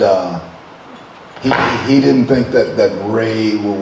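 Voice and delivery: usual speaking voice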